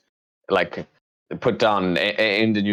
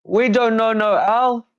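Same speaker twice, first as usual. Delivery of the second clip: high, slow, loud highwayman; bandit voice; 4 dB louder than usual. Acted. Bandit answers John's claim, high loud voice. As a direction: refuse them in the squeaky bandit voice.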